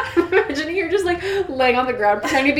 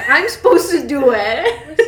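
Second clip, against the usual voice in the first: loud, drawn-out mock whine; protesting; 4 semitones higher, 5 dB louder, slower than usual. A narrator's reading. Mike to Edward about taking Bella, exaggerated protest. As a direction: protest higher and louder, hammed-up and half laughing. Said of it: "Mike protested".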